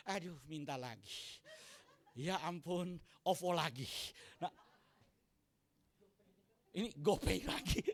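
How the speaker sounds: low, soft weary mutter; exasperated groan. Retold or imitated. Parent reading the child's WhatsApp, mildly exasperated. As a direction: sigh at yet another request.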